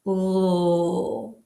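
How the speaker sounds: a long held L with the tongue tip on the ridge; L sound demo. Shown as a demonstration. Teacher modelling a lone L sound for the class. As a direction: hold the L, tongue behind the top teeth. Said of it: "Start with the L sound".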